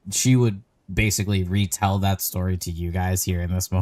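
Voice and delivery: ordinary delivery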